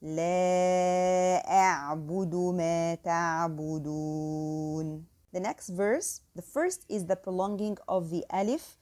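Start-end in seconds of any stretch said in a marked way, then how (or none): 0.0-5.0 slow tajweed recitation of لا أعبد ما تعبدون; measured Quranic chant. Shown as a demonstration. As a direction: recite the verse slowly and evenly. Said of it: "the second verse again".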